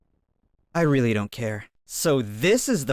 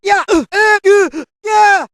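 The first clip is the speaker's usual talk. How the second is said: loud, high fighting-game attack shouts; battle cries; 13 dB louder than usual. Acted. Fighter in combat yelling move names, loud battle cries. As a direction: bark each move name as you strike.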